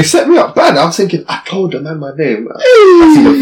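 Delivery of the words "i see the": much higher, louder, slowed; punchline of being set up; dramatic reveal. Retold or imitated. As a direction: announce it like a shock.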